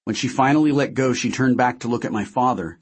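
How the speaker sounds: plain reading voice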